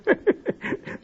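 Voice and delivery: breathy, high gloating cackle; gloating laugh. Acted. Turkey savours his big hand, breathy high cackle. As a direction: wheeze out a smug laugh.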